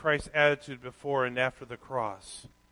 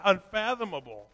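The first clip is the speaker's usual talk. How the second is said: ordinary talk, nothing marked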